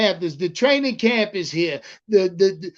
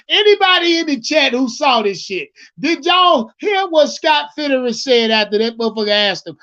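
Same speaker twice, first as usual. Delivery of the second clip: usual speaking voice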